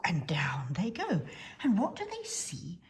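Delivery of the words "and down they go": ordinary delivery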